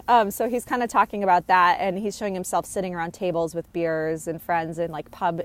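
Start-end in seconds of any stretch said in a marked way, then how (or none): none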